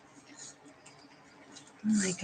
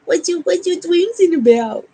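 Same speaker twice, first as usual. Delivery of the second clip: higher and louder, bouncy; excited pet talk; 9 semitones higher, 8 dB louder than usual. Real, not acted. Owner asks the waking dog eagerly, higher and louder. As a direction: perk up and ask the puppy eagerly.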